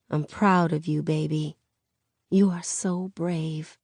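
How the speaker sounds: soft, slowing motherly praise; hushed words during a tight hug; tender pride. Acted. Tearful mother hugs and praises daughter.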